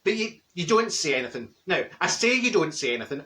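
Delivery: normal talking voice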